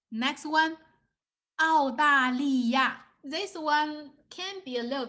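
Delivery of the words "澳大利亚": 澳大利亚 slow, in four clear beats; emphatic slow model of 澳大利亚; slow syllables. Shown as a demonstration. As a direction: give every syllable of 澳大利亚 its own weight. Teacher poses harder word to guess.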